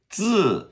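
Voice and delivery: "自" as D straight into buzzing Z, high falling; zi sound demo. Shown as a demonstration. Teacher explaining how Z I is pronounced, buzzed falling "自". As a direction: punch "自" high and loud as a dz buzz. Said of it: "start it with a D".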